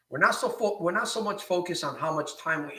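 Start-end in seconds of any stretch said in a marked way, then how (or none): none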